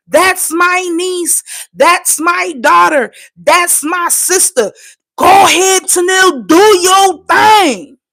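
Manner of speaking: high, drawn-out praise from proud family; mock proud relative, each phrase stretched; imagined proud cheering. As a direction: cheer her on slowly, building to a shout. Voices the praise nobody gave.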